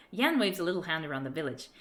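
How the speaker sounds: normal talking voice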